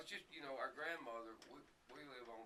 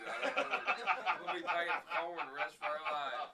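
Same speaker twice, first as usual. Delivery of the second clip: much louder, laughing through the words; laughing while talking; 6 semitones higher, 14 dB louder than usual. Real, not acted. Guest laughs loudly about the cows eating the neighbor's corn. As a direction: crack up as you tell it.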